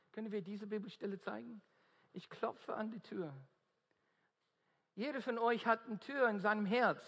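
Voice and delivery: usual speaking voice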